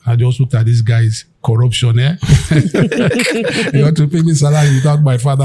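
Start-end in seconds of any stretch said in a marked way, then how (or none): 2.2-3.7 high scornful laugh. Real, not acted. Son mocking the manager's bribe, high scornful laugh. 3.7-5.4 fast, laughing retort. Retold or imitated. Son rejecting manager's offer, fast laughing retort.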